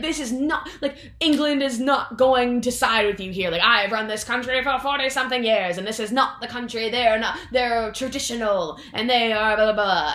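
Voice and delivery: lightly voiced queen laying down the law; stern Queen. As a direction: scold them haughtily. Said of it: "she's like".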